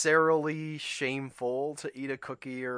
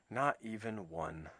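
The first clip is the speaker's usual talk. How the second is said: soft, lower, deflated; sadly; 4 semitones lower, 8 dB quieter than usual. A narrator's reading. Toad mourning the lost cookies to Frog, sad and soft. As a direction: let it sink, disappointed. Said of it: "said Toad sadly".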